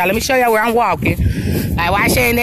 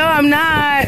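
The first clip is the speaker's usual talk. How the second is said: ordinary delivery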